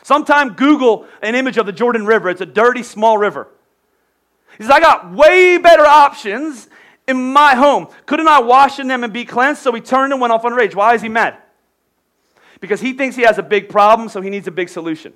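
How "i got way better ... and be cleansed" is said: loud, higher indignant huff; proud general offended by the cure; angry Naaman. Retold or imitated. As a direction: scoff at the dirty little river. Angry Naaman boasts of home rivers.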